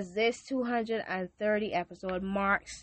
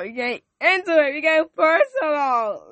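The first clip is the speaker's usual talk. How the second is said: worked up; 8 semitones higher, 10 dB louder than usual. Real, not acted. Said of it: "let's calm down".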